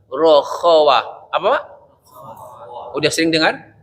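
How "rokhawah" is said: "rokhawah" said slowly and clearly; slow term. Shown as a demonstration. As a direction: spell out "rokhawah" for the students.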